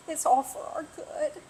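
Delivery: holding back tears while speaking; teary. Real, not acted. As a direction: voice on the edge of tears. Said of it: "I really didn't think I was gonna get teary".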